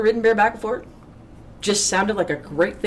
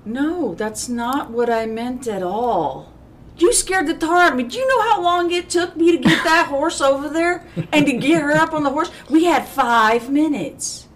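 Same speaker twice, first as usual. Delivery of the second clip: scolding director; 9 semitones higher than usual. Retold or imitated. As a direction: play the riding director telling her off. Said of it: "and she goes".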